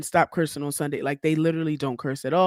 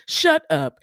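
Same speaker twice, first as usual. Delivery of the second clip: higher and louder, cutting her off; louder sharper shut up; 8 semitones higher, 4 dB louder than usual. Real, not acted. To Latasha, a louder, higher, final shut up. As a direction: snap it shut, final word.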